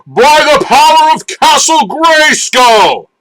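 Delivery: high, slow, ringing call to the heavens; heroic battle cry. A narrator's reading. Prince calling to the heavens to transform, slow ringing shout. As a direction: raise the sword and cry it out. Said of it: "In a strong voice, he shouted to the heavens".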